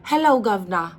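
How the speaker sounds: put-on playful copy of a British accent. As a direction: imitate her British greeting. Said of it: "that accent?".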